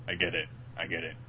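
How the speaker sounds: ordinary talk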